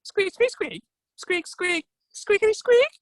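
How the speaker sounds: very high squirrel squeaks; Ratatosk. Acted. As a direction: answer in piercingly high squeak-words. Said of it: "To which Ratatosk replied".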